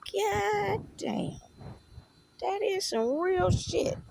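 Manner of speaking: high, hushed astonishment, slow on the second line; a hushed, high wow; retold amazement. Reacting to God's words, high hushed amazement.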